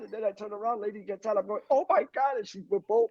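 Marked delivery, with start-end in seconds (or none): 1.7-2.4 higher-pitched mock shock; retold shock. Man seeing lady get out, retold shock. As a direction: replay the startled cry in a raised pitch.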